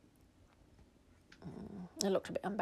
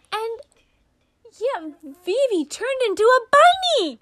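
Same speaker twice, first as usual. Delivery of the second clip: much higher and louder, bursting with the news; excited announcement; 14 semitones higher, 12 dB louder than usual. A narrator's reading. Telling the children Vivi became a bunny, thrilled and loud. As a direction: announce it like the big surprise. Climax of the transformation.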